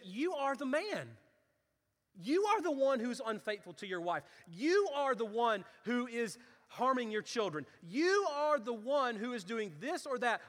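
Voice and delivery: rising sermon fervour; fiery preaching. Acted. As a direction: preach it hard to the congregation. Preaching at full force.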